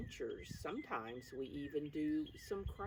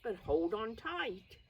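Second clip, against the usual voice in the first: lively, slightly louder storybook reading; reading to children; 4 semitones higher, 4 dB louder than usual. Acted. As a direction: cheer the children on as you read the page. Reads the book text aloud.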